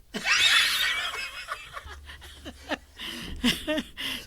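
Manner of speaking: a real shriek of laughter; loud laugh. Real, not acted. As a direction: burst of high, breathy laughter. Funny name mix-up punchline.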